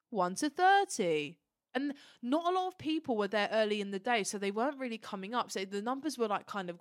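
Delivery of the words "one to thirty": slow, louder imitated announcement; calling out tickets. Steward calling the next ticket numbers, imitated slow call-out. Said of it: "they start calling out autograph tickets".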